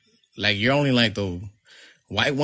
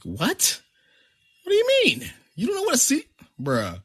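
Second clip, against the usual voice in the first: very high mock incredulity; baffled, voice jumping way up; retold disbelief. Retold amazement at cousin's question.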